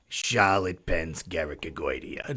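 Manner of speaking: slow deep voiced name; low put-on voice. First take of the name.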